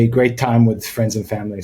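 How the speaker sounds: usual speaking voice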